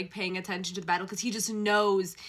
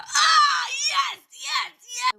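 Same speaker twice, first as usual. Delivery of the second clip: high squealing shout; fangirl shriek; 11 dB louder, much slower than usual. Real, not acted. Fan reacting to trope, squealing shout. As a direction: shriek with delight.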